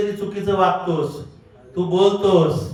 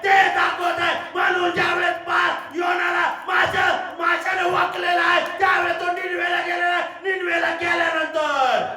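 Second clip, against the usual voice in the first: shouted Bible retelling; preaching at full force; 8 semitones higher than usual. Real, not acted. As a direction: drive the Jonah story home.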